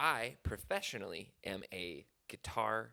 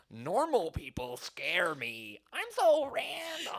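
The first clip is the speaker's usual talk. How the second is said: put-on high, slow, squeaky quirky teen; hammed-up Tumblr girl, drawn out; 8 semitones higher, 3 dB louder, slower than usual. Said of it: "twenty fourteen Tumblr era that was like".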